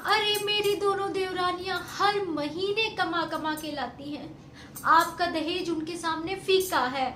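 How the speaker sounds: loud, high, furious tirade; angry outburst. Acted. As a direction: hurl the dowry back at her mother. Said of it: "ऐसे गुस्सा करती है". (गुस्से में ऊँची आवाज़ में फटकार)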